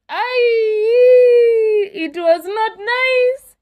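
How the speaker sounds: high, slow, half-joking sob on the words; mock crying wail. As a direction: wail it like a hurt child, not quite in earnest. Repeats line, then breaks into cry.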